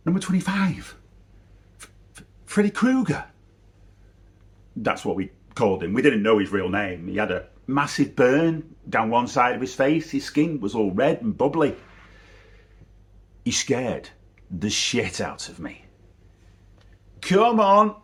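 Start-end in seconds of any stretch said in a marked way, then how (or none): 0.1-3.3 high, alarmed echo breaking into stutters; scared stammer. Retold or imitated. Retells boy's fear at friend's suggestion. 17.2-18.0 put-on high, loud urging from a boy; Billy's voice. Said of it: "said Billy".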